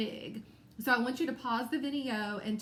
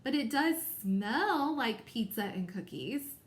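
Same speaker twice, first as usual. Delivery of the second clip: slow model reading with "smell" drawn out and emphasized; stress on smell. Shown as a demonstration. Teacher repeating the example for students, stressing "smell". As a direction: punch and lengthen "smell" in the sentence. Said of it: "I put a little bit more emphasis on that".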